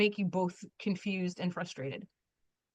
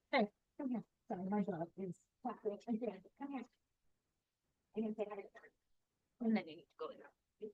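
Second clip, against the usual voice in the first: much softer, turned away from the mic, coaxing the dog; talking to the dog; 12 dB quieter than usual. Real, not acted. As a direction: softly call the dog over, half off-mic. Sorry, my dog is tackling again.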